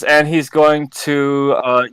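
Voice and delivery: ordinary talk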